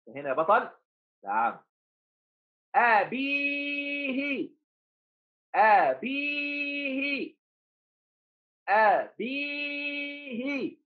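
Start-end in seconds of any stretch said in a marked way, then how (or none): 2.8-10.8 أبيه with a high, long-held ee; very slow أبيه, short a, long bee, falling hi; drawn-out dictation. Shown as a demonstration. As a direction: sing out the middle vowel of أبيه. Slow dictation stretching the long vowel.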